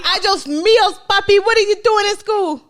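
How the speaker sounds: high, loud mock scolding mother; shrill mock mom telling her kid off; imagined mother scolding her son back home, hammed-up. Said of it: "She probably was like".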